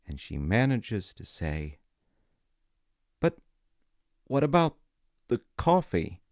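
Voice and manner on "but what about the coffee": higher and louder, blurting it out; a sudden, pleading call after him; determined outburst. A narrator's reading. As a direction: force the question out before he leaves. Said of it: "she is determined to not let this".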